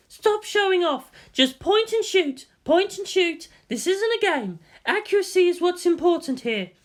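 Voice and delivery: angry scolding. Acted. Ritsuko scolding Shinji in the simulator, shouting. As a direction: bark each command in exasperation. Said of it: "Ritsuko shouted".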